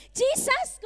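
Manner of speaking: high, loud cry of sudden recognition; startled cry. A narrator's reading. Mary suddenly recognizing Jesus at the tomb, startled cry. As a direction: gasp out the name in shock. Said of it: "bigla nang nagulantang si Mary".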